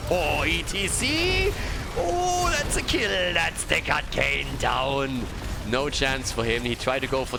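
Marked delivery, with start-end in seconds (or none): 0.1-5.2 high, loud burst at the kill; real play-by-play excitement; excited shout. Real, not acted. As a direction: yell the kill call, thrilled. Caster reacts to a kill.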